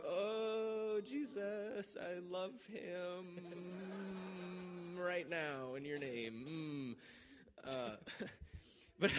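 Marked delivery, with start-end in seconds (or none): 0.1-7.0 high, very slow drawn-out prayer voice; mock fervent prayer. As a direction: pray dramatically over the hurt foot. [name] praying over the foot.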